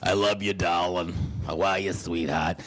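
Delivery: low raspy Beetlejuice-style mother. Retold or imitated. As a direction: croak it out like the Beetlejuice guy. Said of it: "My mother sounds like the guy from Beetlejuice".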